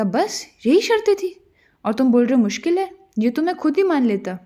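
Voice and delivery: overjoyed disbelief. Acted. King to Parizad, scoffing at easy conditions, overjoyed. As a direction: pitch leaps high, a delighted king scoffing at easy terms.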